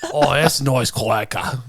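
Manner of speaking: slow, hammed-up Aussie accent; Australian accent. Shown as a demonstration. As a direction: savour it in a broad Australian voice. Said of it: "in an Australian accent".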